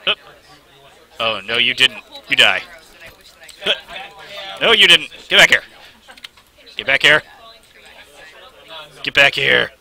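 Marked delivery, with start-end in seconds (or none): none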